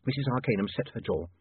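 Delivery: plain reading voice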